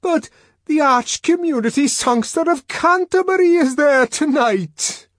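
high, loud desperate wail; shrieked despairing protest; almost in tears. A narrator's reading. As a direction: plead loudly, on the verge of tears. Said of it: "Bernard was almost in tears".

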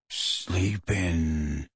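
deep, hushed character voice; low murmur near a sleeper; breathed. A narrator's reading. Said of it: "breathed Hagrid".

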